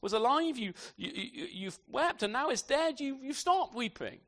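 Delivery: high, puzzled and stammering; baffled servants. Retold or imitated. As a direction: stumble over the words in disbelief. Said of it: "And they're baffled".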